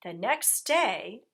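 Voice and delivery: ordinary delivery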